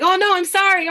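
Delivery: hammed-up sorry from a caught kid; mock kids' whine. Teacher imitating a student caught saying something wrong. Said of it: "And they're like".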